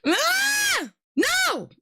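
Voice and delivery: high, sudden frightened yelp; startled scream. Real, not acted. Reacting to a ghost video, a startled high yelp. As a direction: jump out of your skin.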